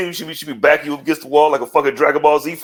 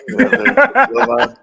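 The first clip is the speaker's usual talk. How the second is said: slow, much higher put-on voice, drawn out; sly recruiter impression; 9 semitones higher, slower than usual. Host mocking a backstage recruiter pitch, slow and high. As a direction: tempt him slowly with a strange high voice. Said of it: "talking like N B A players".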